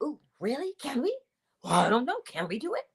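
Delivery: small, hesitant, questioning voice; quietly unsure, wavering between questions; doubtful. Acted. Body answering the mind's intention, doubtful.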